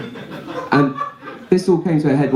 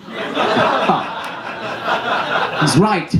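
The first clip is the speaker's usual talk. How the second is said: stung realization; 6 semitones higher, much slower than usual. Retold or imitated. Teen reacts in story.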